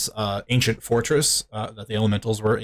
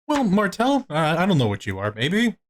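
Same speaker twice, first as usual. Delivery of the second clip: higher-pitched, hesitant mock query; puzzled questioning; 7 semitones higher than usual. Game master playing someone sizing up party members, puzzled and higher. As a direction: ask it uncertainly, lifting at the end.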